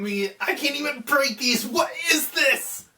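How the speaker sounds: shout, straining against the spikes; frantic disbelief. Retold or imitated. Muzan can't break spikes.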